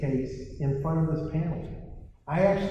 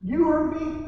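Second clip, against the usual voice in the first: ordinary talk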